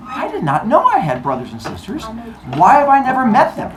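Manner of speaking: put-on high, indignant young Zeus; playfully outraged astonishment; acted disbelief. Zeus learns of his siblings.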